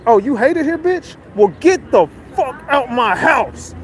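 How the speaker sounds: mock indignant challenge; retold angry eviction. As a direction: act out throwing someone out, stretching the command. Retold boss throwing her out.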